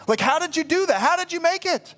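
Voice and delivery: high-pitched amazed questioning; incredulous questioning. Retold or imitated. Preacher imagines asking the thief how he made it, incredulous. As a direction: ask in sheer disbelief, pitch way up.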